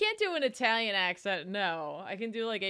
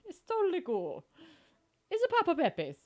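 higher, mock Italian plumber voice; Mario; 5 semitones higher than usual. Streamer voices retired plumber shrugging it off, crappy mock Mario. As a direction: do a crappy Italian-American pizzeria owner. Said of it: "look at retired Mario".

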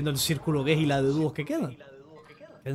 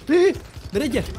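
shouting; 10 semitones higher, 10 dB louder than usual. Real, not acted. Shouting direction.

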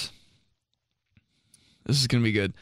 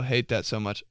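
ordinary delivery

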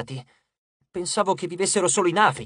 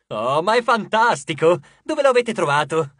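gleeful; 5 dB louder than usual. Acted. God flips through his almanac, giddy with delight. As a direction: bubble over with joy.

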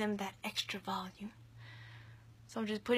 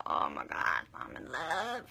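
swooning; 9 semitones lower than usual. Real, not acted. Swooning over mascara.

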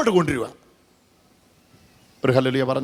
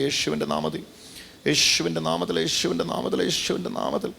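murmured invocation; 6 dB quieter than usual. Acted. Preacher chants over the sick man, hushed then building. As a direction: chant the name softly, building.

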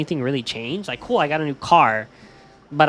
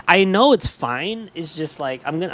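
normal talking voice

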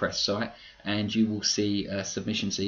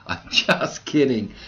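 laughing it off, a bit louder; 4 semitones higher, 7 dB louder, slower than usual. Real, not acted. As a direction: laugh through the take-back. Teasing joke, then takes it back.

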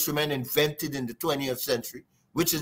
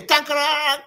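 imitated drum sound; 10 semitones higher, 4 dB louder than usual. Shown as a demonstration. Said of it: "it sound like that".

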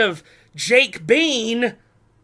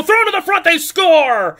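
goal call; 6 semitones higher than usual. Real, not acted.

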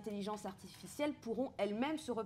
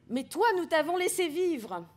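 réplique de robot, plus aiguë et plus forte, sans timbre robotique; robot sans voix de robot; 7 semitones higher, 5 dB louder than usual. Acted. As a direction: lire le robot haut et fort, sans imiter une machine. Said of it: "Je ne vais pas faire une voix de robot". (voix de robot refusée, juste plus haute et plus forte)

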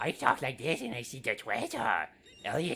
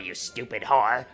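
ordinary delivery

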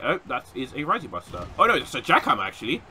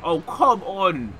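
long, drawn-out higher complaint; exasperated cry; 6 semitones higher, 4 dB louder, much slower than usual. Real, not acted. Gamer hit by a setback, exasperated cry. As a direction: stretch it out in frustration.

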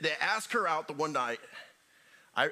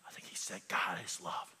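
soft whisper, lower; whispered comeback; whispered reply; 10 semitones lower, 8 dB quieter than usual. Shown as a demonstration. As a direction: lean in and whisper it. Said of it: "you look at him and say".

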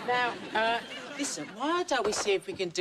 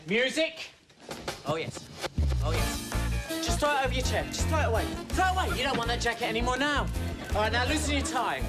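high, gushing photographer egging her on; coaxing photographer; 5 semitones higher, faster than usual. Acted. Photographer urging the model to shed jacket, excited. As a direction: whip up the model with breathy delight. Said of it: "photographer directs the shoot".

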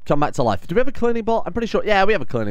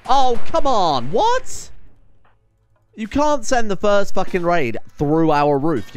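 loud, high, drawn-out protest at the raid; real frustration boiling over; exasperated outburst; 8 semitones higher, 5 dB louder, slower than usual. Real, not acted. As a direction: let it out, slowed and loud. Raid hits through the roof.

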